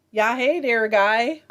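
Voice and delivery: a loud, jokey Michigan Upper Peninsula "hey there, guy"; mock Yooper accent. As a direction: throw out a hammed-up Yooper greeting. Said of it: "I can't really do that accent".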